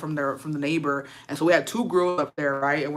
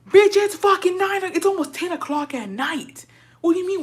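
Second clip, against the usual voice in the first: outraged exclamation; 11 semitones higher than usual. Real, not acted. Outraged retelling of late dinner.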